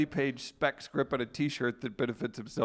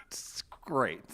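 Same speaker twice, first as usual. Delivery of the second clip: whispered aside; 3 semitones lower, 6 dB quieter than usual. Real, not acted. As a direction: breathe it out in a whisper.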